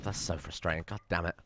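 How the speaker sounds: frustrated mutter. Real, not acted. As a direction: grumble it to yourself, almost inaudible. Frustration at losing walls.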